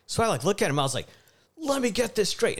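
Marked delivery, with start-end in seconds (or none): 1.6-2.6 high, sharp yelled challenge; heated call-out across the counter; retold yell. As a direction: confront him, a heated yell. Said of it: "And I, I yell it".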